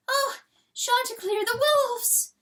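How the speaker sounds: high, loud anguished wail. A narrator's reading. Hen wailing the news of wolves, high anguished cry. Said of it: "she wails".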